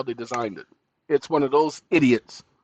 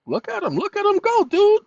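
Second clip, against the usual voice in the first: much higher excited cheer; 4 dB louder than usual. Real, not acted. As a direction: cheer the bot on, way up high. Excited watching code generate.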